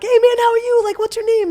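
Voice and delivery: high, loud, bubbly hello; over-eager, upbeat introduction; friendly greeting. Retold or imitated. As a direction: greet a stranger with big welcoming energy. Acted friendly greeting to strangers.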